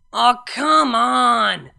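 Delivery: loud drawn-out groan of frustration; frustrated exclaim. A narrator's reading. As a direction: wail it out, fed up and drunk. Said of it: "Vinyl exclaimed in frustration".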